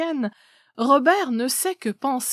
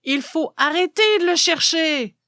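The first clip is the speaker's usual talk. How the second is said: raised, higher exclamation; proclaiming loudly; 7 semitones higher, 4 dB louder than usual. Acted. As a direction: cry it out to the crowd.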